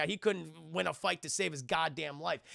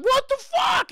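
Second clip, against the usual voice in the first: sudden high yelled curse, much louder; shocked shout; 9 dB louder, slower than usual. Retold or imitated. Man soiled by the dog yelling in shock. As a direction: blurt it out at the top of the voice. Dog owner splattered, retold scene.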